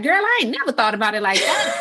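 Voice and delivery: put-on tossing it off fast with a laugh like to a girlfriend; laughing sassy quote. Mother quoting herself admitting her kid changed her mind, laughing. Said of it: "I'm like".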